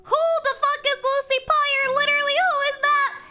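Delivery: high, loud laughing indignation; laughing outrage. Real, not acted. Streamer mocking an unknown name, loud laughing. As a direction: yell it while cracking up.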